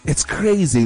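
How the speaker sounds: ordinary delivery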